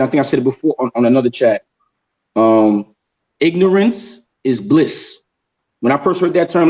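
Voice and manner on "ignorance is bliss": ignorance is bliss said very slowly, every word weighed; slow emphasis. Shown as a demonstration. Host stating his key saying to viewers, slow and weighty. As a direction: lean on ignorance and draw the phrase out. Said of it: "let me say that again".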